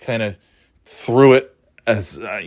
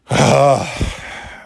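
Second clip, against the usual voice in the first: exasperated sigh; 3 semitones higher than usual. Real, not acted. Man fed up with kids, exasperated sigh.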